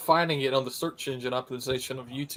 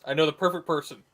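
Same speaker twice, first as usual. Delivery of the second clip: ordinary talk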